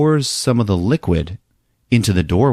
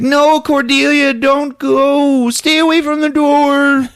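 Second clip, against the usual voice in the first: very high, louder pleading falsetto; panicky falsetto; 3 dB louder, slower than usual. Retold or imitated. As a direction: beg her in a squeaky panic. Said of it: "Wally's like".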